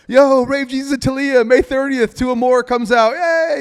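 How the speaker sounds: hammed-up announcer hype; excited hype. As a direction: pump up the crowd on camera. Hyping release on live TV.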